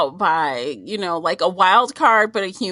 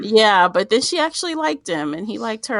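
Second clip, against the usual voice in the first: usual speaking voice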